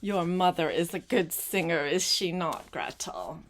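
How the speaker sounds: usual speaking voice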